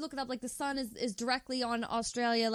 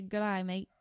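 "day" turned toward "die", Aussie style; Australian accent; 5 semitones lower, 3 dB quieter than usual. Shown as a demonstration. Correcting the co-host's take, in an Australian accent. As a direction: model the Australian greeting, low-key. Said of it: "without sounding Australian".

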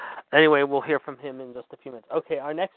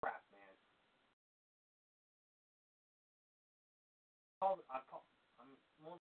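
far from the mic, much softer, grumbling complaints; off-mic phone argument; 16 dB quieter than usual. Real, not acted. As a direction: complain to the caller from across the room. Host arguing on phone off mic.

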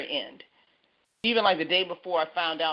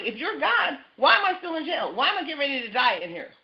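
high, exasperated pleading to God; retold despairing complaint; upset, frustrated plea; 8 semitones higher than usual. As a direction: cry out why in frustration. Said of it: "he was upset and he was frustrated".

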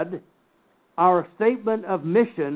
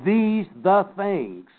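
slow, weighted stress on "These the things"; emphatic; 3 semitones higher, much slower than usual. Shown as a demonstration. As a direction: draw out "These" and "the", giving each word its own weight. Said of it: "that means it is emphatic".

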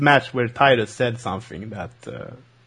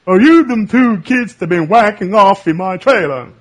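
higher, louder cranky trailer owner accusing; Mister Anderson impression; 7 semitones higher, 6 dB louder than usual. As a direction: bark it at two kids caught in your trailer. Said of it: "You sounded like that character in".

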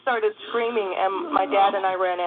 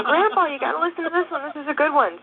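put-on higher, cheerful kid brother voice; imitating the brother; 6 semitones higher than usual. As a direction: act out the brother, who doesn't know grandpa is dead.

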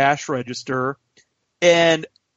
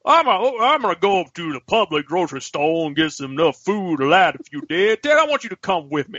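mock dad voice, pitched up at the start; dad impression; 3 semitones higher than usual. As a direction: imitate your dad announcing an errand. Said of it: "That's how my dad sounds".

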